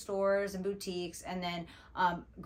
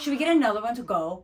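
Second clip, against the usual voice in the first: louder, quick, eager plea for more; a loud, rushed, half-joking request for seconds; eager hopeful ask; 4 semitones higher, 9 dB louder, faster than usual. Retold or imitated. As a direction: beg for another cup like a greedy kid. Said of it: "And I'm like".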